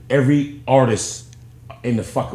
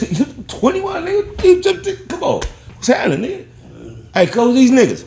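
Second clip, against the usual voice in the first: much higher squeaky voice, retelling the big homie's excited greeting; imitating the big homie; 12 semitones higher than usual. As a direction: pitch it way up to mimic the older homie.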